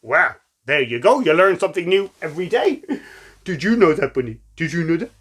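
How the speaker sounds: usual speaking voice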